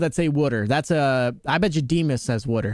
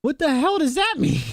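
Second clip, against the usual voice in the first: mock baffled; 11 semitones higher than usual. Acting out baffled reaction.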